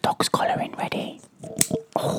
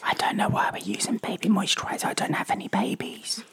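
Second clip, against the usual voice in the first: whispering; 10 semitones higher than usual. Real, not acted. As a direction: whisper close to the mic. Whispering ASMR chat.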